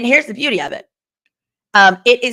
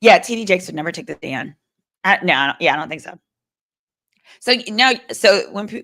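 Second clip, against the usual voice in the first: ordinary talk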